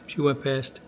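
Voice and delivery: ordinary delivery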